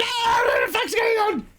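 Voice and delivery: very high, shrieking mock panic; mock panicked shout. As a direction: yelp like you're being beamed up by aliens. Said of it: "you're like".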